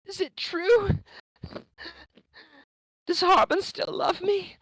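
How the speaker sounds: high, eager hopeful questions; desperate hope in her voice; hopeful plea. Acted. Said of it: "And he's like".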